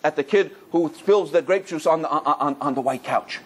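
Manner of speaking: usual speaking voice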